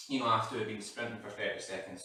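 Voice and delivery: usual speaking voice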